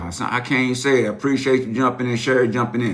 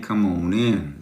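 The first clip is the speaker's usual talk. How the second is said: ordinary delivery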